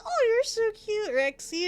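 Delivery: slow, high mock-adoring coo; mock cooing chat. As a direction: gush like chat talking to a pet. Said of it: "You guys sit there and you're like".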